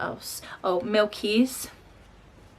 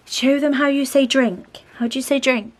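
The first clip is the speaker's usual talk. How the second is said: higher coaxing voice; 6 semitones higher, 8 dB louder than usual. Real, not acted. Mum asking her toddler to show a sign, coaxing. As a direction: coax a shy little one to show off.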